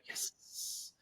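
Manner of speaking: hushed, voiceless little cheer; whispered triumph. Retold or imitated. Instructor watching client walk off alone, whispered triumph. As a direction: whisper it as a private celebration. Acts out quiet triumph at independence.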